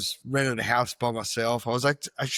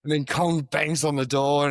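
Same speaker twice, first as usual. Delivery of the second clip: ordinary delivery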